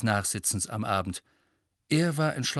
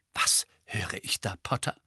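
hushed, threatening sharpness; sharp. A narrator's reading. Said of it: "sagte Professor McGonagall scharf".

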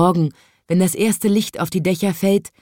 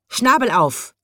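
shouting; 6 semitones higher than usual. A narrator's reading. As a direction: order it sharply. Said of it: "schrie der Storchenvater".

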